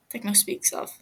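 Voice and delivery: ordinary delivery, nothing marked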